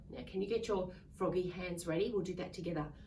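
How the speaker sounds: plain reading voice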